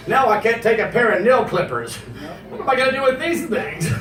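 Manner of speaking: ordinary delivery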